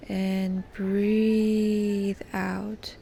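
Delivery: "breathe" held very long before "out"; drawn-out breathing cue. Shown as a demonstration. Guide pacing the listener's exhale, drawn-out breathing cue. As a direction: stretch "breathe" slowly to pace the release.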